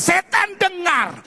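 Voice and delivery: high, loud, slow order to a demon; forceful exorcism cry; commanding shout. Acted. Acts believer commanding a demon.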